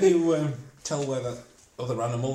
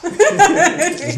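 laughing; 11 dB louder than usual. Real, not acted. Laughing while teasing a friend.

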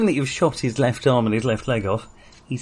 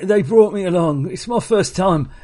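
high, stammering plea; nervous pleading raider; 6 semitones higher, 3 dB louder than usual. Acted.

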